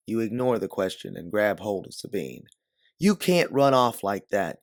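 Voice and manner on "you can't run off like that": higher, louder scolding; grabbing a friend and scolding her, sharp reprimand. Acted. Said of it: "you reprimand her".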